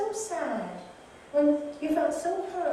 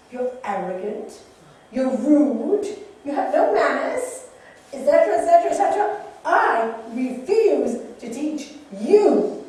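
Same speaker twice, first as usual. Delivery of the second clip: insulting monk; 2 semitones higher, 11 dB louder than usual. Acted. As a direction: berate the samurai with rising scorn. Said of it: "And the monk goes".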